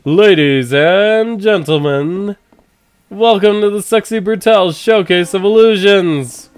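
showman announcer. Acted. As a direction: welcome the crowd like a ringmaster. Show announcer opens the show.

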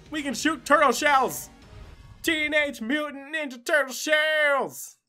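normal talking voice

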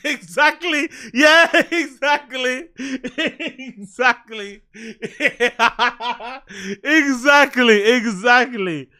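drawn-out words through real laughter; laughing agreement. Real, not acted. Host agreeing with a viewer's message, laughing hard.

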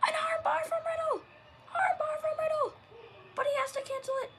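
loud, high announcer shout; wrestling commentator. Acted. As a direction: scream the hold like ringside.

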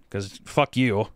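ordinary delivery

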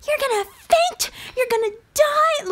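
shrill it like a worried mom; panicky mom. Retold or imitated. Daughter imitating her worried mom, high panicky warning. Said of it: "She's like".

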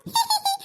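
high, polite fake giggle; high-pitched staged titter; put-on giggle. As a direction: cover up with a little high laugh. Fake laugh before greeting aunt.

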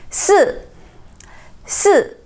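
"四" starting high and falling sharply; fourth tone. Shown as a demonstration. Teacher drilling number four, falling fourth tone. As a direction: drop "四" down from a high pitch. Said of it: "which is fourth tone".

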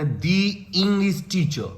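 "the" said as "dee", slowly; "dee" before English. Shown as a demonstration. Teacher repeats the English teacher slowly with "dee". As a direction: give a slow, clear "dee" for "the" before "English". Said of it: "ই sound হচ্ছে vowel sound".

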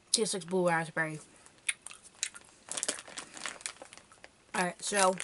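talking while chewing; chewing candy. Real, not acted. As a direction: mouth partly full. Seen 2: Worm held at lips, bitten at 1.7, chewing with full cheeks after.

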